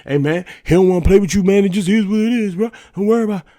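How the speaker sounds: father brushing off his son's hurt, loud and breezy. Retold or imitated. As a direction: shrug him off, bro to bro. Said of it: "my first instinct was to go".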